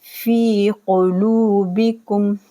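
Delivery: फी कुलूबिकुम with फी and लू held long; slow recitation; drawn-out fi and lu. Shown as a demonstration. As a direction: stretch फी for two counts, then लू. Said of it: "फी को खींचेंगे".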